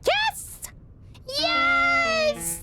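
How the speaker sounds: very high, loud victory cry; triumphant shout. Real, not acted. Hunter celebrating her first fox kill, triumphant yell. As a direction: cheer the kill.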